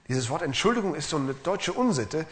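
ordinary delivery

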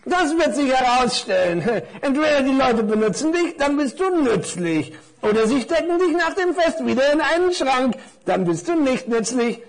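high-pitched little explainer; knowing necktie. Acted. (belehrende Krawatte mit hoher Stimme)

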